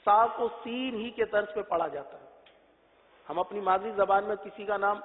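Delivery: ordinary delivery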